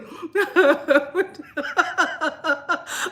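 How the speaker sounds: real uncontrollable giggling fit; crying with laughter. Real, not acted. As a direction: laugh until the tears come. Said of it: "I'm crying literally".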